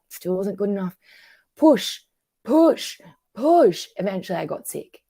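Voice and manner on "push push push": "push" punched louder and higher each time; emphatic repeated push. Acted. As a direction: hit each "push" as its own hard beat. Acts out pressure to try harder.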